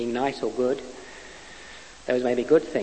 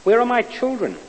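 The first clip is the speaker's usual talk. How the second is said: put-on a louder, higher cry for his children; desperate cry; 8 semitones higher, 6 dB louder than usual. A narrator's reading. Man alone in the dark cries out for his children. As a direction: call out for the lost children in desperation. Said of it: "Again he cried".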